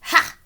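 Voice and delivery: loud scornful ha; snort. Acted. McGreely mocking the bunnies with a loud snort. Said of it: "he snorted".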